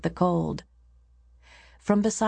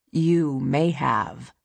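slow, lower-pitched snap; snapped retort; 4 semitones lower, much slower than usual. A narrator's reading. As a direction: snap it slowly, in a low pitch. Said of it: "the high priest snapped".

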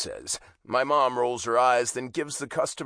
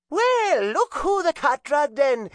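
loud, high Irish mother calling out; Irish accent; 6 dB louder, slower than usual. A narrator's reading. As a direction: yell it in a thick Irish brogue. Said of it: "my mom yells in her thick Irish accent".

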